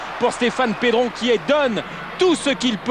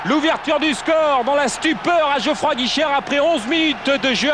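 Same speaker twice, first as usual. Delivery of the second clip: excited sports commentary; 3 semitones higher than usual. Real, not acted. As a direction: yell the goal as it goes in. Goal scored in live commentary.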